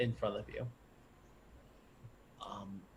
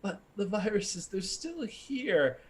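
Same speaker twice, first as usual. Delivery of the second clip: small pleading voice; 9 semitones higher, slower than usual. Acted. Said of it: "the voice responds".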